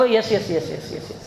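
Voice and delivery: meek Bengali yes, trailing off; cowed yes. Retold or imitated. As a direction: yes yes shrinking away to nothing. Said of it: "देख लेना आप".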